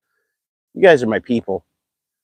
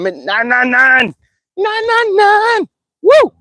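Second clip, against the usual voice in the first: excited countdown; 3 dB louder, slower than usual. Real, not acted. Streamer counting down the last sub, rising excited squeal. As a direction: pitch climbing higher and louder with each nine. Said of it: "my voice is getting so high".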